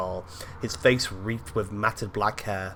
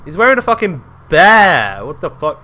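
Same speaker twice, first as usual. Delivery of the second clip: loud, high excited outburst; excited amazement; 8 semitones higher, 15 dB louder than usual. Real, not acted. Amazed at game character's bearskin.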